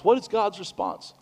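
loud, high preacher's proclamation; preaching at full force. Acted. Pastor proclaiming the rebels' boast, loud preaching. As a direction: proclaim it to the whole congregation.

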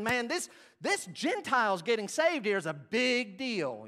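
high, loud pulpit retelling; full-force preaching. Real, not acted. Preacher retelling Peter's rooftop vision to congregation, full-force preaching. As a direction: cry out the story from the pulpit.

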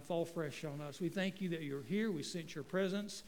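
ordinary talk

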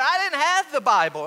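high, loud excuse; voicing an indignant objector; protesting group. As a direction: shout a mock protest. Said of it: "So this group over here".

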